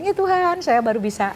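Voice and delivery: high, anguished cry to God; retold crying. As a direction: plead to God as if in tears. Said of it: "Saya menangis". (meniru tangisan, memohon kepada Tuhan)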